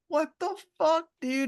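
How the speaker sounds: very high shocked cry; shocked squeal. Retold or imitated. Characters shocked as Cassius shoots Severo, high squeal. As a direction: shriek it in disbelief.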